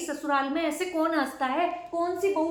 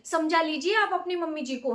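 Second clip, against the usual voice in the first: ordinary delivery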